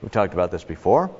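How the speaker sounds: ordinary talk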